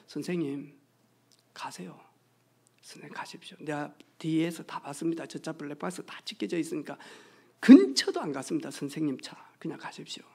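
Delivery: soft, near-whispered plea; hushed urging. Retold or imitated. Preacher quietly urges a startled driver to go, soft hushed plea. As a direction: quietly urge the driver to just leave.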